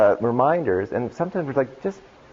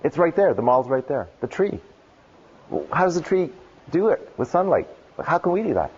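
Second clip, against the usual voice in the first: pitched well higher, eager discovery; excited realization; 6 semitones higher than usual. Retold or imitated. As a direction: voice a wide-eyed aha about the tree. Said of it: "I'm like".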